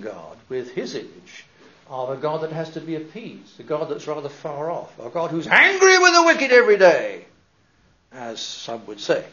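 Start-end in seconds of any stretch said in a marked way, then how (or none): 5.0-7.2 stern preacher. Retold or imitated. Mock stern preacher image.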